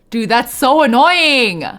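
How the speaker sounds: loud, high, drawn-out whine; exasperated outburst. Real, not acted. Streamer blocked from her story quest, loud exasperated whine. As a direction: groan it out loudly, stretching the words.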